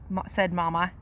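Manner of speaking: ordinary delivery, nothing marked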